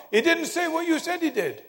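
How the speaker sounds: put-on high-pitched child's protest; little boy. Little boy correcting the preacher, high child's voice. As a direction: argue back like an indignant child. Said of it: "one little boy".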